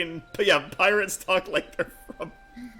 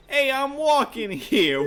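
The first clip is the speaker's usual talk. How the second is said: put-on Brooklyn accent; 8 semitones higher than usual. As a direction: yell it like a cabbie stepping into traffic.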